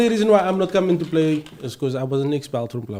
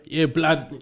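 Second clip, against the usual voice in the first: lightly done London roadman slang; roadman accent; 3 semitones lower, 5 dB quieter than usual. Retold or imitated. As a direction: drop a London roadman "blood". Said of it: "how the roadmen speak with it".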